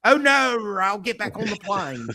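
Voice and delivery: hammed-up Australian protest; Australian accent. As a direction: put on a broad Aussie accent, voice pitched right up. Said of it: "that's my Australian accent".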